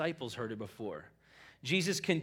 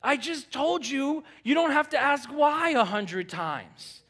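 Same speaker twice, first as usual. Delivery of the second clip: higher, louder, slow exasperated scold; a fed-up father snapping at his kids; exasperated parent; 9 semitones higher, 6 dB louder, slower than usual. Retold or imitated. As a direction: scold kids asking why again and again. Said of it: "I'm like".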